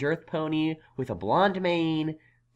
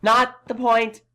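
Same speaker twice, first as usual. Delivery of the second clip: louder, snapping it off; exasperated outburst; 4 semitones higher, 8 dB louder than usual. Acted. Unicorn losing patience with the stubborn farmer, loud. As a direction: cut him off, fed up.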